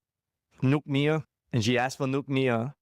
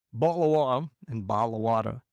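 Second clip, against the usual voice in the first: first "bottle of water" Scottish with a dropped t, second American with an r; Scottish then American; 2 semitones lower than usual. Shown as a demonstration. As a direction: contrast the two accents side by side. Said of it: "are very different".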